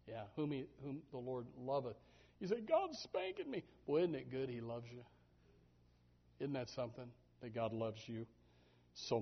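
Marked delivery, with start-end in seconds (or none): 2.6-3.6 high, slow mock whine; high-pitched mock complaint; whiny complainer. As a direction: whine like a spanked child. Quoting a complaining believer.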